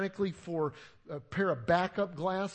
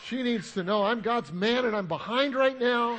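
higher, loud mock outrage; indignant self-importance; 6 semitones higher, 11 dB louder than usual. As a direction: protest loudly, full of your own importance. Re-enacts his self-important irritation.

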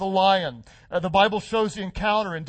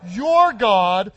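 your God, with your punched louder; stress on your; 8 dB louder than usual. Shown as a demonstration. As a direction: hit the word "your" hard. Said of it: "Say, say it again".

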